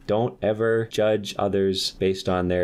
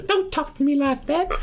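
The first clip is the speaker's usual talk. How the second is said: high put-on voice, indignant; scolding wife. The kangaroo wife punching her husband, scolding in a high put-on voice. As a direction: squeak out an offended wife.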